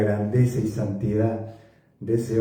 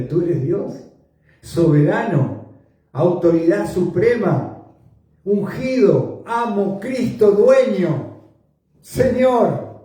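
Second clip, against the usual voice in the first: rising confession; 7 semitones higher, 4 dB louder than usual. Acted. Simon's confession, climbing.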